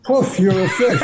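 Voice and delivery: laughing while delivering the punchline; a giggle breaking into the punchline; laughing at his own joke; punchline through a laugh. Acted. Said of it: "dips his hand ... and says".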